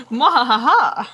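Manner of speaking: hammy evil laugh, falling at end; gleeful villain cackle; mad scientist cackle. Acted.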